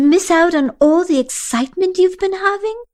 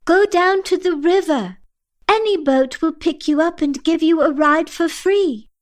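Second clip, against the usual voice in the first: calling out; 7 semitones higher than usual. A narrator's reading. Asha floating upward yells directions, calling out. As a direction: call down as if from above. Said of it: "continues floating upward and yells".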